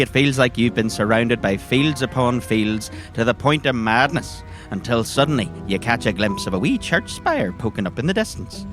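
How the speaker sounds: ordinary delivery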